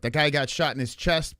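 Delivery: ordinary delivery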